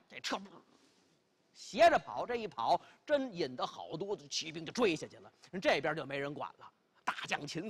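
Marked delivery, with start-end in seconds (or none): none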